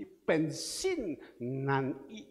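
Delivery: Pensin nan i drawn out very slowly, rising at the end; Chinese phrase read out. Shown as a demonstration. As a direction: stretch out Pensin nan i like a question. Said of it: "dia katakan sini". (Pensin nan i dibaca sangat pelan dengan nada naik)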